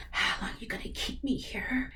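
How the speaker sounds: whispered mock of the actress's serious delivery; breathy copy of her dropped serious voice; imitating Ellie's low serious tone. Reactor mocking Ellie's serious line, whispered. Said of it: "her tone goes down".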